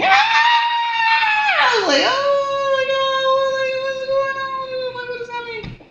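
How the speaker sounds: high, loud, drawn-out panic; a very high, loud scream turning into frightened questions; panicked shriek. Retold or imitated. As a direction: cry out in alarm, then slowly ask what's happening.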